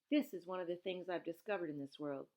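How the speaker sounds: ordinary delivery, nothing marked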